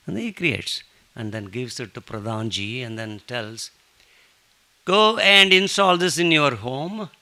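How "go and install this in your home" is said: loud, slow, higher commanding voice; the saint ordering his devotee to stay home; emphatic command. Retold or imitated. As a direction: lay down the law loudly and slowly. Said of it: "he says emphatically".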